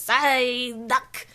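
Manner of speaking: lightly voiced cartoon creature calling its name; drawn-out little creature cry; Psyduck impression. Fan imitating a Pokemon saying its name, creature voice. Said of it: "but he goes".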